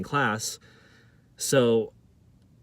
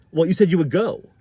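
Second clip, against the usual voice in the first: higher, louder accusing objection; retold anger; 5 semitones higher, 7 dB louder than usual. Office lady holding the student to his word, angry. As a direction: imitate her indignant comeback. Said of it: "She's mad".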